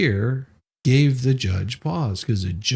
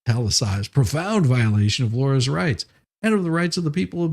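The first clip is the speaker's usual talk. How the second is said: reading legal text aloud in a raised, pompous tone; mock formal brief reading; 6 semitones higher than usual. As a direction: declaim it like a self-important lawyer.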